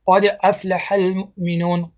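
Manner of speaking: قد افلح المؤمنون recited slowly with a short zabar on قد; Quranic recitation demo. Shown as a demonstration. Teacher reciting the verse for students to copy. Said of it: "دیدین؟".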